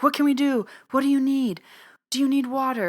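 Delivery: high-pitched, mildly urgent imitation of worried onlookers; urgent retold questions. Worried onlookers offering the shaking woman help, urgent and high. Anxious bystanders pressing her with questions.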